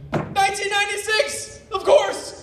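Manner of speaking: high, slow excited cry; excited exclamation. Acted. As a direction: Dookie shouts out his sudden realization. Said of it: "Dookie suddenly exclaimed".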